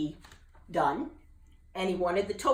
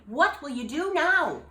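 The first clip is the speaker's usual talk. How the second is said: higher, louder curious bullfrog; bullfrog asking; 9 semitones higher, 7 dB louder than usual. Acted. Jubal asking Gertie, curious. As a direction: ask eagerly.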